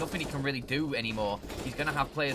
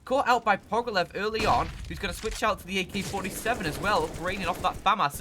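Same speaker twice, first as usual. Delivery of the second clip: esports caster; 8 semitones higher, 6 dB louder than usual. Acted. Hyped play-by-play burst.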